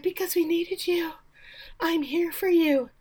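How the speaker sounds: high-pitched mock-tender voice; syrupy consoling. Woman consoles the injured coach, mock tender. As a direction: coo it like an over-devoted nurse.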